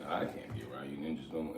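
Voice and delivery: quick, muttered quote under the breath; retold line, mumbled low and quick; hushed aside. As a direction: toss it off quietly and fast. Retold quote of walking away.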